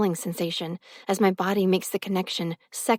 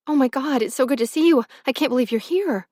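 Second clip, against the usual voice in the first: joyful gush, higher and louder; overjoyed; 5 semitones higher, 4 dB louder than usual. Acted. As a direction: burst out with delight at the reunion. Overjoyed reunion with Dust.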